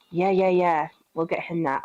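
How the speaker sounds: normal talking voice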